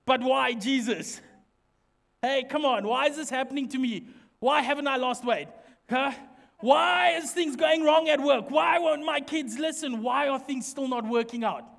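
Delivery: loud mock complaint to heaven; exasperated pleading. Believer praying to Jesus about everyday troubles, exasperated pleading.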